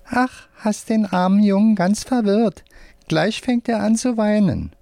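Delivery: high-pitched little puppet voice; teasing hand puppet. A narrator's reading. Puppet teases a boy about crying, high puppet voice.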